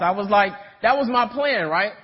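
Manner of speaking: ordinary delivery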